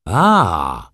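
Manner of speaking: loud, drawn-out "ah" of discovery; exclamation. A narrator's reading. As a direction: call out as if it just clicked. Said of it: "zawołał". (głośny okrzyk olśnienia)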